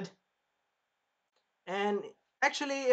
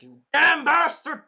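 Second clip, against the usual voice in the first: much higher, loud, slow crying yell; anguished shout; 11 semitones higher, 13 dB louder, slower than usual. Acted. Naofumi screaming at the spear hero, anguished cry. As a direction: scream it through tears of rage.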